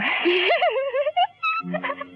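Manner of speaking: short high bitter laugh. Acted. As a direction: laugh at yourself, half broken. Patient laughs bitterly at doctors.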